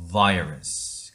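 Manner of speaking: Virus said as vai-rus, stressed first syllable; slow clear Virus with the vowel between 어 and 아; model word virus. Shown as a demonstration. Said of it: "시작". (천천히 또박또박 Virus 시범 발음)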